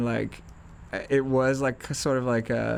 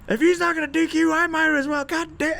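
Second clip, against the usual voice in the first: high, loud furious rant; furious coach; 14 semitones higher, 4 dB louder than usual. Retold or imitated. Coach raging before throwing a clipboard. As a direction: bellow it in rage, much higher and louder.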